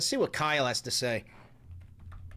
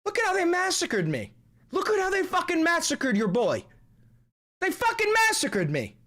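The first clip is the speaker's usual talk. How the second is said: much higher, whiny mock outrage; whiny outraged caricature; 5 dB louder than usual. Streamer mocking an offended figure, whiny high outrage. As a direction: squeal like someone offended by a meme.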